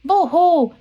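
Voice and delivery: high, storybook boo-hoo; mock crying. Baby fish has lost its mommy, mock crying. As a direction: pretend to wail like a little fish. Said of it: "sees a baby fish".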